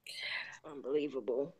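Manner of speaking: a real whisper of shock at the news; stunned whisper. Real, not acted. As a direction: breathe it out in a hushed, disbelieving whisper. Stunned reaction to a murder case.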